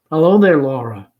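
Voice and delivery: a light teasing hello; a hint of the easygoing caller; gently humorous. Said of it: "Jim's attitude is gently humorous".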